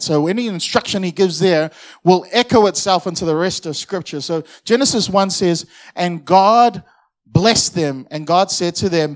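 loud preacher's cadence; preaching. Acted. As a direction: preach it to the congregation. Preaching at full force.

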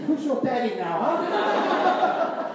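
laughing taunt, rising high on the last word; mock taunting Joseph. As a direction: taunt him playfully through laughter, pitch shooting up. Jokes as offended Joseph, laughing.

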